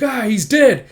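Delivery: loud, high shocked shout; alarmed cry. Acted. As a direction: cry out the bad news. Soldier finds captain dead.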